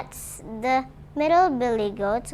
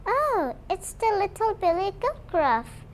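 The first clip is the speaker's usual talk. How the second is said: much higher, small little-goat voice; 8 semitones higher than usual. Acted. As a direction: pipe up like a tiny timid goat. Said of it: "answered the little Gruff in his little voice".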